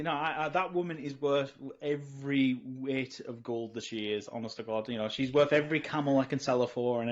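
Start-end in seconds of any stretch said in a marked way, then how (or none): none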